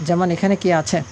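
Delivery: normal talking voice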